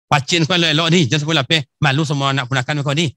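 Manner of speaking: put-on quick Minang accent with a laugh in it; a Sumatera Barat uncle joking fast, laughing. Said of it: "nampaknya Sumatera Barat".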